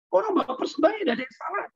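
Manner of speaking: high, squeaky put-on voice of puzzled staff; mock staff reply. Voicing the staff's puzzled answer. (suara staf bingung yang ditirukan, melengking tinggi)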